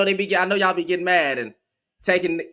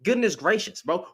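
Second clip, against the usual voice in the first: ordinary talk, nothing marked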